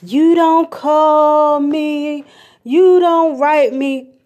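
whiny grandma nagging her grandchild; complaining grandma. Retold or imitated. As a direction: lay the guilt on slowly like a hurt grandmother. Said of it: "my grandma used to complain".

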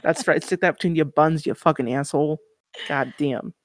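loud, hammed-up New Yorker insult. Guest finishes off the pretend heckler, loud New Yorker accent. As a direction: tell them off like a brash New Yorker. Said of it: "that very New Yorker".

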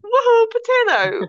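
high, loud playful cheer; excited cheer. Real, not acted. Cheering at her cat.

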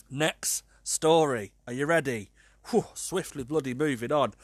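ordinary talk

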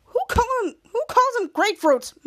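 acting out a baffled retort; mock disbelief. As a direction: question it in exaggerated disbelief. Retelling an incredulous comeback.

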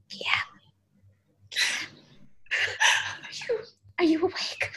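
soft unvoiced whisper, afraid to wake him; hesitant whisper. Waking a sleeping Guillaume at dawn, whispered. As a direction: barely breathe the name, then the question. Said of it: "but you don't really wanna wake them up".

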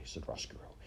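ordinary delivery

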